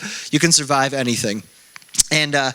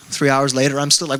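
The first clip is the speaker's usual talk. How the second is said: usual speaking voice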